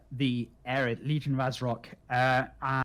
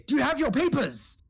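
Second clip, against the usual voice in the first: official hobgoblin demand; 12 semitones higher, 3 dB louder than usual. Acted. Luresh as guide demands papers.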